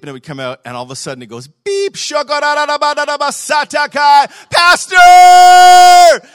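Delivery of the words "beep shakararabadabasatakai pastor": very high, slow, full-volume holler; excited shouting. Retold or imitated. Excited church member leaves his pastor a message, ecstatic shout. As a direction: imitate an overjoyed caller yelling into the machine. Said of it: "And he was so excited".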